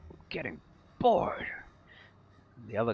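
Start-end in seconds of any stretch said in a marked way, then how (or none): 0.1-1.5 bored goblin whispering. Acted. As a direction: breathe it out like a sulky goblin. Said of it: "one of them goes".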